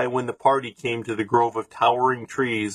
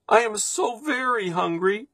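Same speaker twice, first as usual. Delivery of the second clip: put-on high, pleading child's voice; small hungry boy; 10 semitones higher than usual. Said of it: "said the boy".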